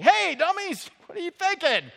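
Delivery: high, loud mock rebuke; mock scolding. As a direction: jokingly berate them as dummies. Imagined harsh Jesus scolding disciples.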